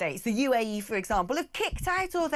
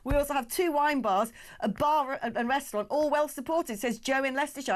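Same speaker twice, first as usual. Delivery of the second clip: ordinary delivery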